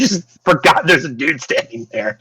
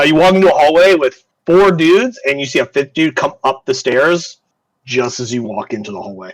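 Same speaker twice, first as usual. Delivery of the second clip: usual speaking voice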